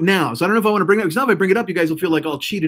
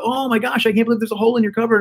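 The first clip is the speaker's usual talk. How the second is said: higher-pitched mock amazement; astonished lady visitor; 7 semitones higher than usual. Imagined visitor spots the hole in the cupboard, mock astonished.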